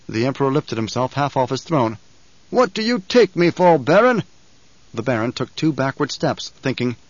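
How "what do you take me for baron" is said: angry. A narrator's reading. Emperor threatening the Baron.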